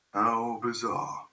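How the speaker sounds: drawn out. Real, not acted. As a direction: linger on each word of the remark. Repeats it slowly, drawn out.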